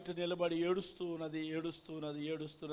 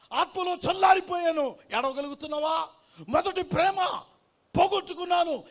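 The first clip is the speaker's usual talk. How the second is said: high, loud, insistent cries to the congregation; full-force preaching; 11 semitones higher, 9 dB louder than usual. Acted. Preacher challenging believers who cannot weep, full-force preaching. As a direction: drive each challenge home at a shout.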